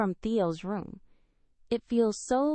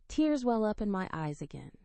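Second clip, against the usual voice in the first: usual speaking voice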